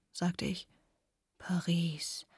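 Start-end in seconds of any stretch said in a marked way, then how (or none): 1.4-2.3 soft, low whispered word; dreamy murmur. Acted. Father at dinner musing on Paris, dreamy murmur. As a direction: breathe it out dreamily. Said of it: "murmelte mein Vater verträumt".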